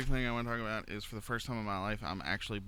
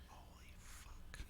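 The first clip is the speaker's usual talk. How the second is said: whispered; 22 dB quieter, much slower than usual. Real, not acted. Realizes guest not on mic.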